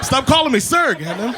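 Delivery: normal talking voice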